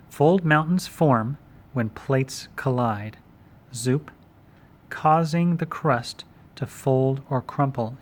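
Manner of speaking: slow, spaced dictation. Shown as a demonstration. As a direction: give it slowly for notes. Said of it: "dictating answer slowly".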